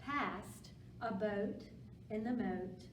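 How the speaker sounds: usual speaking voice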